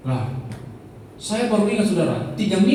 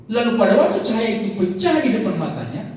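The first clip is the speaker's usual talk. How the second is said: usual speaking voice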